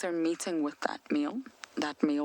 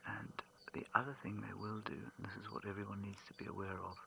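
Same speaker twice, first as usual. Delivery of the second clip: very soft whisper so as not to disturb the cubs; hushed den whisper; 5 semitones lower, 15 dB quieter than usual. Real, not acted. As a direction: whisper low, keep it barely audible.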